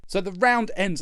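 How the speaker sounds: normal talking voice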